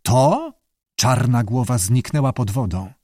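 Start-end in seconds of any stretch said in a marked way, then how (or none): 0.0-0.5 high, startled rising question; choked little question. A narrator's reading. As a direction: force the word out in shock. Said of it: "wykrztusił".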